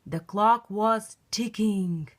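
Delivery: usual speaking voice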